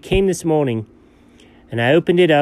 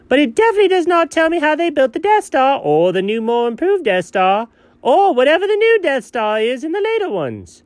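exasperated; 10 semitones higher than usual. Real, not acted. Exasperated rant about Death Star.

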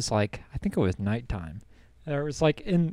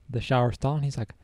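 ordinary delivery

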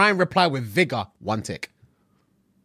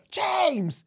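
retold scream; 12 semitones higher, 3 dB quieter than usual. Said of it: "she's screaming out the window".

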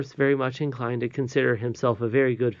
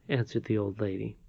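usual speaking voice

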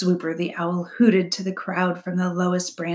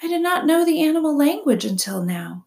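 high, louder, measured robot voice; robot character; 9 semitones higher, 5 dB louder than usual. A narrator's reading. Robot answers the owl's accusation, high and measured. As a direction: explain it clearly, pitched up.

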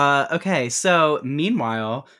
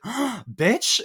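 gasp, then a high, stunned exclamation; hammed-up high-pitched astonishment; mock shocked gasp; 10 semitones higher than usual. Wife hears husband's whispered raise, hammed-up shocked gasp.

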